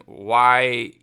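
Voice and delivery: ordinary talk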